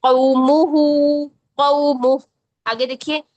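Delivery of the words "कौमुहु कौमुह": कौमुहु then कौमुह, stopping on the ह; slow recitation with a rounded waw; Quran recitation drill. Shown as a demonstration. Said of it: "तो चलिए". (तिलावत के अंदाज़ में धीमे पढ़ना)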